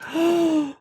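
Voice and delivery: high, drawn-in gasp of surprise; sharp high intake of breath. Real, not acted. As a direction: gasp in delighted shock. Surprised the card is theirs.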